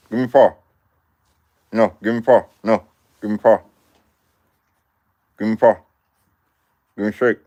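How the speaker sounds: high, loud sing-song commands to a dog; coaxing the dog. Real, not acted. Coaxing dog to shake, paw.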